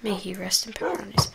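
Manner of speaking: breathy mock solemn whisper. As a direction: whisper the farewell at the grave. Repeating the eulogy whispered.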